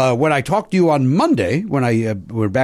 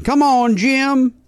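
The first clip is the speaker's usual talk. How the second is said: roommate Kurt; 12 semitones higher, much slower than usual. Retold or imitated. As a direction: pitch it way up like the roommate. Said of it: "That's, that's Kurt".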